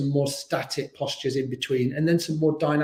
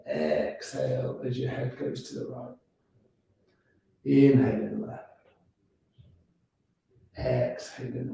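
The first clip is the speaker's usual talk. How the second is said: guided yoga instruction. Acted. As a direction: ease them through each breath. Guides neck stretches slowly.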